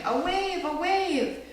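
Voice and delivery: put-on high, excited little-girl cry; child thrilled by the waves; child squealing. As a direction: squeal with delight at the surf. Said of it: "I squealed".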